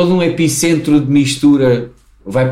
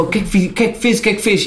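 higher, tense demanding questions; angry mother, imitated; 6 semitones higher, faster than usual. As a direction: ask it like an outraged mother. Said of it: "Tipo, a fa".

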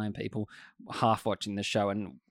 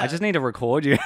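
ordinary delivery

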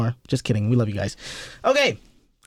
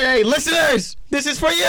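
announcer voice; 10 semitones higher than usual. Acted. Addresses the podcast audience.

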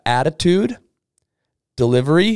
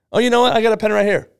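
much higher, louder eager customer; eager relief; 9 semitones higher, 3 dB louder, much faster than usual. Shown as a demonstration. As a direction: buyer jumps at the easy ask. Customer eagerly offering pen.

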